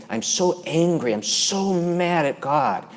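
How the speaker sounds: high, slow voice of an aggrieved woman; a hint of the wounded woman, lifted and slowed; pained woman's quote. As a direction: draw it out high, like her hurt breaking through. Woman voiced telling her anger.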